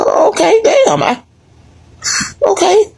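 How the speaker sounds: high, deflated okay; mock hurt reaction. Retelling his own stung reaction, high and deflated. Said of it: "I said".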